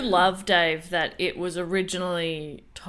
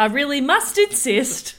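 politely impatient; 6 semitones higher than usual. Acted. Mock corporate email voice.

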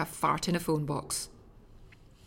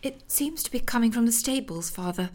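1 helpful daughter; 4 semitones higher than usual. Acted. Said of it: "remarked Clifforless helpfully".

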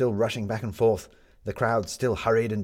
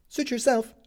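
put-on high, puzzled young boy; boy asking, voiced high. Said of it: "said the boy".

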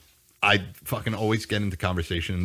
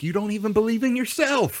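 mock high jab; 13 semitones higher than usual. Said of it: "And I'm like".

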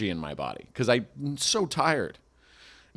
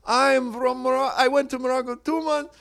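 much higher mock Russian-accented heckle; drunk Russian lady; 13 semitones higher than usual. Drunk Russian woman heckling the comic, mock Russian accent. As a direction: play the drunk Russian tourist interrupting.

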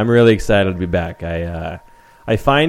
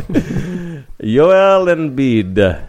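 put-on very slow, drawn-out Italian accent, pitched up; mock Italian accent; 6 semitones higher, much slower than usual. Trying out the player's name for co-hosts, mock Italian accent. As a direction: savour each syllable in a put-on Italian accent. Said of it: "you did that with an Italian accent".

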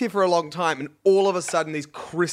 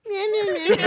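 high bleating mockery; mocking whiny voice. Mocking the witnesses.

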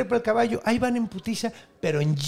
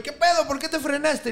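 high, startled outburst; as if the horse just slammed the brakes; startled hero; 9 semitones higher than usual. Acted. Hero shouting at his horse, startled and high.

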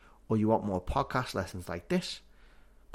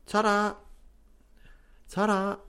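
high, louder sing-song Scouse "Ta-ra!"; Scouse goodbye; 10 semitones higher, 3 dB louder than usual. Shown as a demonstration. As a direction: call out "Ta-ra!" like a Liverpool farewell. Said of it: "Means, Goodbye".

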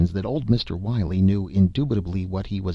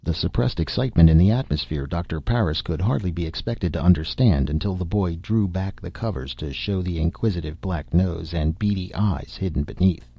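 ordinary delivery

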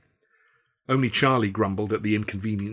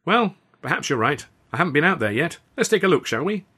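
high, louder, overeager cheer; jolly him along nervously; forced breezy invitation; nervous bluster; 8 semitones higher, 5 dB louder than usual. A narrator's reading. Nervously steering husband outside.

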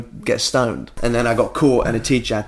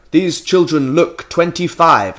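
posh politician voice; 3 semitones higher, 5 dB louder, much slower than usual. Retold or imitated. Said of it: "loud, posh voices".

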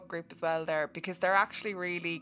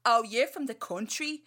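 put-on loud, higher Dublin-accent jeer; mimicking Dublin friends; 5 semitones higher, 7 dB louder than usual. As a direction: imitate teasing inner-city Dubliners. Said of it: "they were like".

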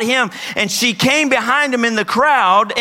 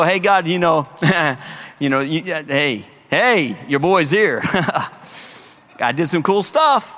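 cocky boast; 6 semitones lower than usual. Acted. Mocking a boastful believer.

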